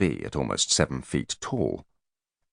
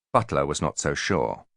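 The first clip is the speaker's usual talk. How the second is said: usual speaking voice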